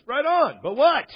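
loud, high burst of incredulity; exasperated questioning. Real, not acted. Preacher challenging a televangelist's seed claim, loud incredulity. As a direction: pitch it up in baffled protest.

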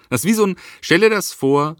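ordinary delivery

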